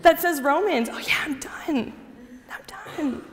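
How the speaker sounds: dry whispered aside; whispered mockery. [name] mocking her new Bible, whispered. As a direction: whisper it, mock bored.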